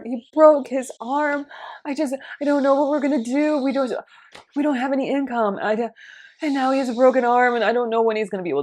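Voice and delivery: high, pleading voice of a woman down on her luck; whiny complaining woman. Retold or imitated. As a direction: pile on the misery like a sob story. Woman pours out her troubles.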